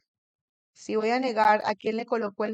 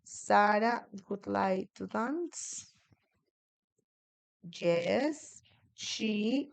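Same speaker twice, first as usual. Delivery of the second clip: slow model sentence; much slower than usual. Shown as a demonstration. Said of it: "como el auxiliar aquí es would".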